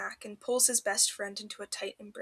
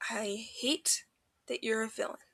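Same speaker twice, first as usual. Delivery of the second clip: quiet admission; 6 dB quieter than usual. A narrator's reading. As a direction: say it softly, wishing it untrue. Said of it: "quiet, as if saying it softly".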